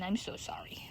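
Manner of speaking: soft whispered sorry; whispered apology. Real, not acted. Owner apologising to a ewe for her bright light, whispering.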